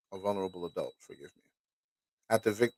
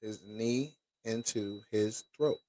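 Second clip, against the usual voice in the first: slow, softer, word-by-word repeat; stunned, drawn-out rereading; slow disbelief; 8 dB quieter, slower than usual. Real, not acted. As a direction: let each word sink in, rising at the end. Dwelling on it in disbelief.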